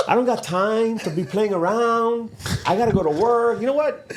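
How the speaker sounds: high-pitched exasperated brush-off; fed up, voice climbing as you refuse to play along; acted-out weary dad protest. Retold brush-off of son's call.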